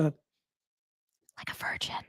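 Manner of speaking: slow low whisper on virgin; whispered aside. Real, not acted. Vlogger whispering a cheeky joke to viewers, hushed and slow. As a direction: breathe the words out like a secret.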